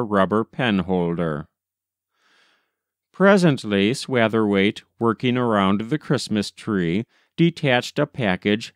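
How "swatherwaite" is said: usual speaking voice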